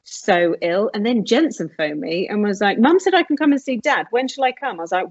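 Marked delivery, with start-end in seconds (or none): 2.8-4.8 put-on eager child asking. Voicing Jensen asking to visit.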